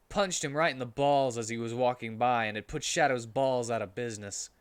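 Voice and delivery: ordinary delivery, nothing marked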